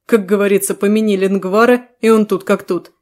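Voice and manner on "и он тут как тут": usual speaking voice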